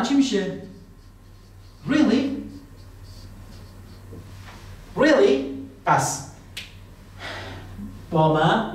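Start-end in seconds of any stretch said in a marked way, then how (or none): 1.9-5.4 high, surprised "Really?"; teacher's surprised "Really?". Shown as a demonstration. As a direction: sound genuinely surprised. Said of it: "واقعاً".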